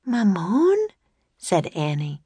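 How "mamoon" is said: put-on high, bright young girl. A narrator's reading. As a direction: voice Annie as an eager girl. Annie voiced by narrator.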